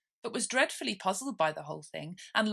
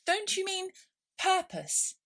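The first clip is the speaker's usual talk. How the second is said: great surprise; 13 semitones higher, slower than usual. Acted. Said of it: "said Alice".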